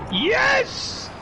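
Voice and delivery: high, loud victory yell; triumphant shout. Retold or imitated. Celebrating his upset win, a high triumphant yell. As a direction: scream it out like you just won.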